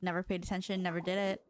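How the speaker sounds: ordinary delivery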